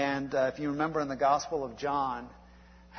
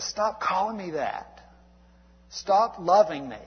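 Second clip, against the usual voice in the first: raised, louder protest pushing him away; a woman fed up, telling him to stop; frustrated outburst; 5 semitones higher, 5 dB louder than usual. Retold or imitated. Preacher retelling the play, voicing Aldonza's frustrated outburst. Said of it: "she gets so frustrated".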